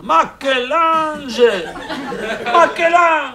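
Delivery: put-on high, slow, loud Italian-accented shout; Italian father shouting, strong accent. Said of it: "il s'écria en français avec un fort accent italien".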